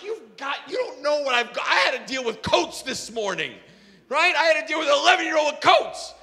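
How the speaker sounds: high, exasperated protest; acted flustered outburst; heated retort. Acted heated retort to yelling man.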